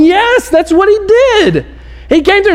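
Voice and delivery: hammed-up enthusiastic yes; eager agreement. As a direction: cheer the answer like an excited fan. Preacher voicing crowd agreement.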